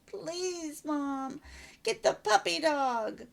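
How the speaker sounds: put-on a small boy tugging at mom's dress; little boy begging.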